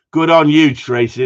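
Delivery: a big, loud, hearty cheer. Real, not acted. Host loudly cheers viewer Tracy for her comment. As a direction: shout your approval.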